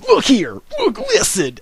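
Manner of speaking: high, loud mock catchphrase; exaggerated high-pitched imitation; Wings of Redemption. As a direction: yelp it out like a mocking impression. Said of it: "when he says".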